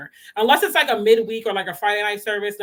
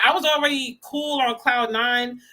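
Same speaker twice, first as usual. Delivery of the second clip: ordinary delivery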